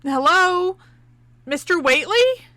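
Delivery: loud, high call across the yard; projected hail into the empty yard. Acted. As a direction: shout to find someone at the farm. Calling out across the farm.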